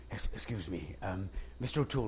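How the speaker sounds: soft, low, hesitant approach; imitating a shy, halting fan; nervous. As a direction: pipe up timidly to your idol, barely audible. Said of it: "he was all nervous".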